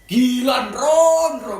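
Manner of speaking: loud, high amazed shout; shocked shout. Real, not acted. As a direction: blurt it out in disbelief. Shocked at five exes.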